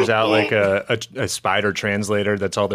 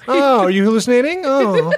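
mock baby talk; 12 semitones higher, 4 dB louder than usual.

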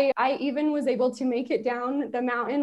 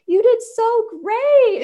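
high, slow gushing praise, much louder; teacher's gushing praise; 11 semitones higher, 7 dB louder, slower than usual. Retold or imitated. Piano teacher praising an unprepared student, gushing high. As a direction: gush like a proud piano teacher. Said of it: "my teacher would be like".

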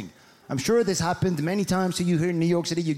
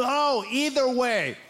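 slow, high, drawn-out brush-off; breezy American answer; 4 semitones higher, slower than usual. Retold or imitated. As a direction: imitate a casual American, stretching the words. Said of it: "they always tell you".

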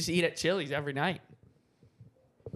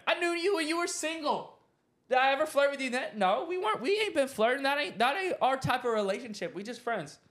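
high-pitched, incredulous retort; indignant protest; 10 semitones higher than usual. Retold or imitated. Replying to a friend who says she has a boyfriend, indignant. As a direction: protest in a high, put-upon voice.

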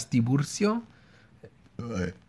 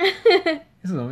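laughing mock voice; 13 semitones higher than usual.

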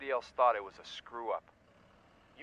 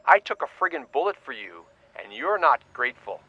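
angry; 10 dB louder than usual. Acted. Greg raging on.

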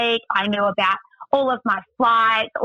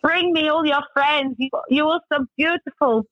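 imitated Russian driver; 6 semitones higher than usual. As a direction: play the driver laying on flattery. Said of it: "And he's like".

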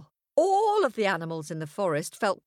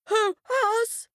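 much higher tiny-creature voice; squeaky innocent; 14 semitones higher, 4 dB louder than usual. A narrator's reading. Animals deny.

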